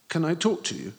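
lightly voiced anxious boy; eager boy voice. A narrator's reading. Nervous boy pleading at door.

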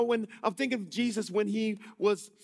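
ordinary talk, nothing marked

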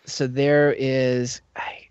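slow secretive whisper, barely voiced. Real, not acted. Telling friends a secret about the ride, whispering.

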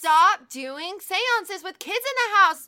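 loud, slow, high scolding; mock scolding shout. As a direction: bellow it like a fed-up teacher. Scolding seance-goers, mock lecture.

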